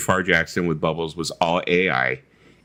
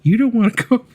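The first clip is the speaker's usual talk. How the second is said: high falsetto imitation; quick, high-pitched put-on warning; 12 semitones higher, 4 dB louder, faster than usual. [name] warning [name] off the cage, amateur falsetto impression. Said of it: "I don't do that great with [name] voice".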